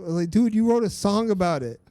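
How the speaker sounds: high, loud, worked-up rant; heated rant. Real, not acted. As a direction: call him out, all fired up. Ranting about song lyrics.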